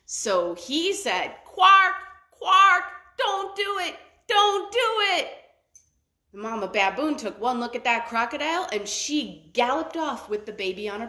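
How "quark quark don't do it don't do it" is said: high loud squawking warning; warning bird call. A narrator's reading. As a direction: screech the warning down from above. Fish eagle warns.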